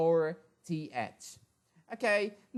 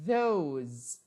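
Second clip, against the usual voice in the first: normal talking voice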